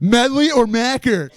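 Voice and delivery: annoying, ignored pestering. Retold or imitated. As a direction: repeat it loudly to someone ignoring you. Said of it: "He ignores me".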